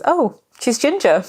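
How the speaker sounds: a surgeon caught off guard; surprised surgeon. Retold or imitated. Surgeons surprised at red hair.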